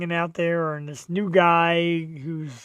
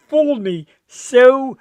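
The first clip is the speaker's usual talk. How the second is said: higher, louder and drawn out slowly; thrilled; 6 semitones higher, 7 dB louder, slower than usual. Real, not acted. Husband telling the host about his surprise party, excited. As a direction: gush about how badly you were fooled.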